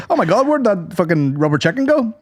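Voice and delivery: hammed-up clown search. Clown looking for his prop: mock patter.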